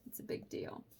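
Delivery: breathy aside; whispered. Real, not acted. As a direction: whisper the slogan. Shirt slogan quoted.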